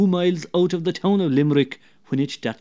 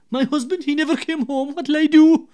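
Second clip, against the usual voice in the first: much higher, pleading woman's voice; worried wife; 11 semitones higher, 5 dB louder than usual. Acted. Wife pleading with the neighbour, high and anxious.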